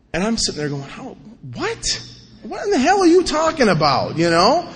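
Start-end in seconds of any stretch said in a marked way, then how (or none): 0.8-4.2 incredulous. Retold or imitated. Bewildered at request to read.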